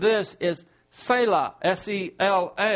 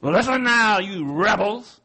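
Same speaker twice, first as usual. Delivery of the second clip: louder stern rebuke, slowing on rebels; angry Moses; 3 dB louder than usual. Acted. Moses rebukes the rebellious crowd, loud and stern. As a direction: scold them as a fed-up leader. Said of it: "this is Moses speaking".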